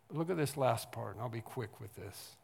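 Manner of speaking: ordinary delivery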